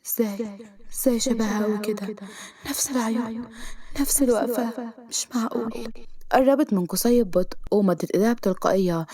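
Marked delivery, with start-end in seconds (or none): 0.1-5.9 soft, stunned thought to herself; inner voice. A narrator's reading. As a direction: think it quietly, in disbelief. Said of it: "سناء في نفسها". (بصوت داخلي هادي مذهول)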